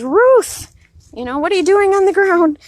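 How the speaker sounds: put-on high, loud call to a fallen old woman; neighbor calling. Neighbor calling out to Grandma Ruth lying in the snow. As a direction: call out to her from the truck. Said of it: "and he says".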